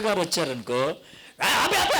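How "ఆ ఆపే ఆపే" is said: high, urgent mock stop-stop; panicked hush. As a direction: scramble to switch off the TV. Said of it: "అంటారు".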